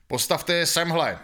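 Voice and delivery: loud, high, slow barked order; commanding. A narrator's reading. Sergeant Krpata orders the prisoners against the wall, loud. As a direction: bark the order at the men. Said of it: "poručil Krpata".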